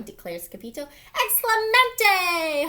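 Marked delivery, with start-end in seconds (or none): 1.1-2.7 high, loud, stretched-out cry; hammed-up Spanish cheer, drawn out; hollered cheer. A narrator's reading. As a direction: holler it slowly as a whole gang. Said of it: "hollered the doggies".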